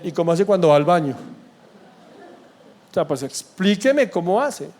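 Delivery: mock-innocent sarcastic question. Pastor retelling his sarcastic reply to a young man, lightly sarcastic. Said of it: "Yo muy sarcásticamente le dije".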